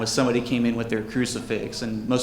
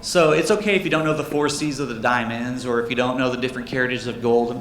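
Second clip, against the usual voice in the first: usual speaking voice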